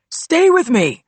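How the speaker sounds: high loud running shout. A narrator's reading. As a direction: call back over the shoulder. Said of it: "the campus cop shouted to me".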